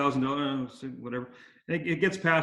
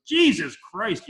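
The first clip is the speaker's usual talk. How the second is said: mock outburst; 6 semitones higher, 6 dB louder, much slower than usual. Ted hearing the price.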